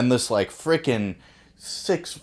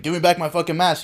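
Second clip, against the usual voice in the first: mock angry demand; 8 semitones higher than usual. Big guy demanding his mask.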